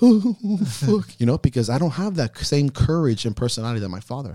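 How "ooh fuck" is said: high, squeaky yelp of panic; a sudden scared squeal; high frightened gasp of a curse; inner panic. Pretend. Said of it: "inside I'm like".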